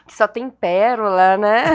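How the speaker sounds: laughing through the words; chuckling about her hometown. Real, not acted. Laughs while talking about hometown. (falando rindo)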